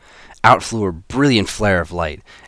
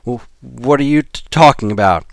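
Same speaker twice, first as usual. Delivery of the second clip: stammering; 5 semitones higher, 5 dB louder than usual. Acted. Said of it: "Alex stammered".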